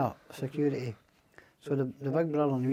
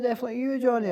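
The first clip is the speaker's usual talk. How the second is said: lightly voiced, higher mock officer on the phone; 10 semitones higher, 5 dB louder, faster than usual. Johnny mimics the prison officer answering his phone call, a higher mocking voice. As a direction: send him up, pitching his reply higher.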